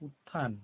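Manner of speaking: উত্থান with ত held into an aspirated থ; both ত and থ sounded. Shown as a demonstration. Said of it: "এটা দুটো উচ্চারণ হয়".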